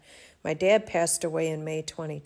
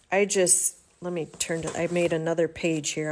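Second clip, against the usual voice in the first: ordinary talk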